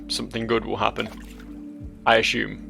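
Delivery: ordinary talk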